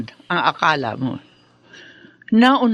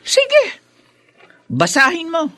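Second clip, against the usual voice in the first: high, smug taunt; high mocking challenge; taunting dare; 8 semitones higher than usual. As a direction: dare him to read it, gloating. Taunting with the contract.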